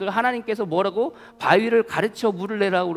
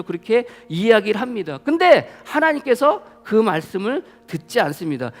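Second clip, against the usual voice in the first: normal talking voice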